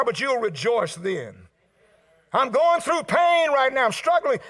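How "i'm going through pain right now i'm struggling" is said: high, loud acted complaint; struggling believer. Preacher voicing someone going through pain, raised pleading lament. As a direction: push it out as a pained cry.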